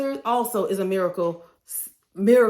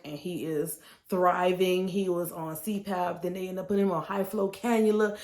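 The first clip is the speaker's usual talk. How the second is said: ordinary delivery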